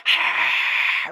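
wordless rush of cheering; breathy crowd-noise imitation; imitated crowd roar. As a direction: mimic a muddled crowd roar. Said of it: "It all sounds like".

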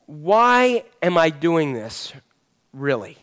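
slow and deliberate, dropping on really; slow, searching question. Shown as a demonstration. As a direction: ask it slowly, letting really sink. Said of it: "ask yourself this question".

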